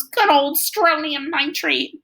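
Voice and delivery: put-on a proud kid greeting an old friend; fond and drawn-out; Freak, delighted. A narrator's reading. Freak greets blue fireworks.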